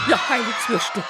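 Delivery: sudden alarmed cry; startled shout. Acted. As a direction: jump and yell it out. Startled outcry as lights die.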